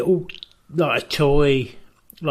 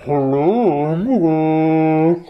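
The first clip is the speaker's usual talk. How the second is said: imitating the caller; 2 semitones higher, 5 dB louder, much slower than usual. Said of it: "he goes like this".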